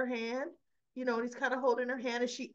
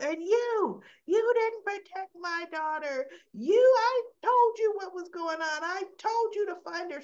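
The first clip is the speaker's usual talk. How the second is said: high, loud accusing rant; imitated angry yelling; 10 semitones higher, 6 dB louder than usual. Heather blaming Laura over her daughter, imitated yelling. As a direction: lay into them, voice pitched way up. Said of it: "she's yelling".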